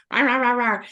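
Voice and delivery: imitated "rah rah" squabbling noise; mock nagging. Wife mimics nagging her husband when he came home, mock nagging. Retells couple nagging at homecoming.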